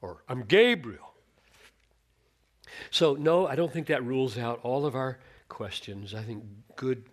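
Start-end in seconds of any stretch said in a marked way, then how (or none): 0.3-1.1 stern reply. Retold or imitated. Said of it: "he will say".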